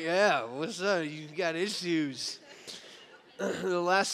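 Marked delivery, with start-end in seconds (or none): 0.0-2.4 cool swagger. Retold or imitated. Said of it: "Some of you like".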